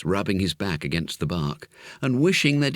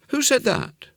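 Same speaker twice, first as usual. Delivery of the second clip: high, startled call; startled boy. Acted. Jimmy hearing an unseen voice, high and startled. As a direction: jump and ask who's there.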